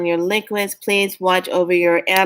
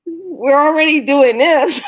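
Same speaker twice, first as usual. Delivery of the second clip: higher and louder, laughing through it; 8 semitones higher, 5 dB louder than usual. Real, not acted. Vlogger laughing to viewers about the heat, laughing. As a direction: laugh out the words in disbelief.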